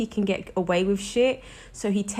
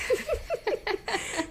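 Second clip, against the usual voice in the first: short high giggle, trailing off; light high laugh; 6 dB quieter than usual. Real, not acted. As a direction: laugh at your own gloomy joke. Laughs at own worry.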